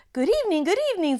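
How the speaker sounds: high excited faun greeting; fawn voice. Acted. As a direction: chirp it out like a flustered faun.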